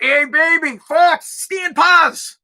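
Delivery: high, drawn-out street catcall; catcalling union guy. Acted. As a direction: holler at her like a Boston worker. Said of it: "the union guy who was working".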